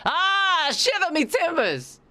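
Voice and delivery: put-on high, slow squealing pirate yell in a Boston accent; Boston pirate scream. Joking to chat about Boston pirates, high slow pirate scream. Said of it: "pirates from Boston just sound like they're screaming".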